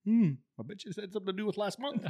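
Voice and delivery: high, quick, wry sing-song; knowing inner voice. Retold or imitated. Young sergeant guessing why he's summoned, wry high sing-song. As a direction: tease yourself about what's coming.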